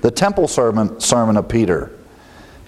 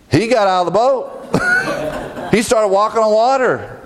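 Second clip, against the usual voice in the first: laughing; 9 semitones higher than usual. Real, not acted. Amused defense of Peter.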